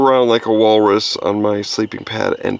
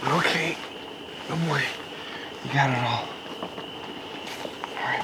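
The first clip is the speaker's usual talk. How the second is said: much softer, near-whispered praise to the dog; hushed; 15 dB quieter than usual. Real, not acted. Owner softly settling dog Monty in the tent at night.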